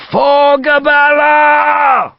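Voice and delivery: very high, loud, long-drawn roar; bellowed war cry. A narrator's reading. Commander leading the bayonet charge, bellowing. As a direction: bellow it, stretching the last syllable. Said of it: "Gough bellowed".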